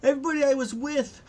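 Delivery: high, loud, rushed wail; acted frantic near-sob; tearful distress. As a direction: cry it out in high-pitched panic. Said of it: "She starts, like, crying".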